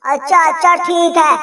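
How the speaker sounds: normal talking voice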